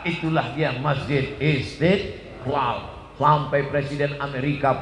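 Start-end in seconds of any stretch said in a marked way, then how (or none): none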